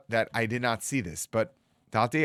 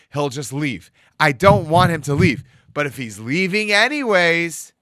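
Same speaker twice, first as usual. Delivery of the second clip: higher, louder, slowed plea; impassioned rabbi; 6 semitones higher, 8 dB louder than usual. Retold or imitated. Rabbi revealing the secret to Michael, raised and fervent. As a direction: build the rabbi's words with fervor.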